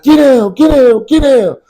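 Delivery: high, loud barked command of get down; retold police shout. Police shouting at him to get down, loud and high. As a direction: yell it like cops swarming the car.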